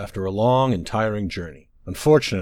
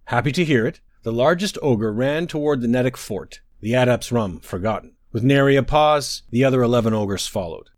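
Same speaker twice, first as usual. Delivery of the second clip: plain reading voice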